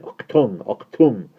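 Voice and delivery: sudden loud mock-German bark; yelled. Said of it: "yelled suddenly at the top of his voice".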